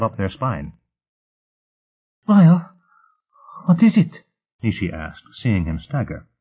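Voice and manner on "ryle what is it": high, loud alarmed cry. A narrator's reading. Nishi sees Ryle stagger, loud alarmed question. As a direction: call out in sudden worry.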